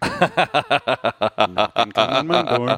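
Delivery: laughing through a slow repeat. Pretend. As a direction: giggle through the title. Laughing while repeating the parody.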